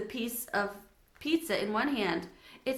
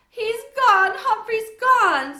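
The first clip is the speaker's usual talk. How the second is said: high, loud howling sob; 13 semitones higher, 12 dB louder, much slower than usual. Acted. Girl howls to family that hamster is gone. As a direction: howl in grief. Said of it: "Sophie howled".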